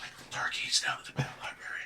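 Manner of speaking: unvoiced whisper, half joking; whispered aside. Real, not acted. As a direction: slip the joke in under your breath. Whispered joke suggestion.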